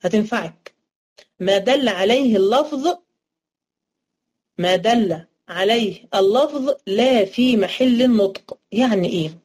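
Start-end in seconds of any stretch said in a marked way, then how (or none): 1.4-8.6 slow, word by word, for writing down; slow dictation. Shown as a demonstration. Teacher dictating a definition for students to write, slow and deliberate. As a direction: spell it out slowly so students can copy. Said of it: "اكتبيها عندك، هتنفعك".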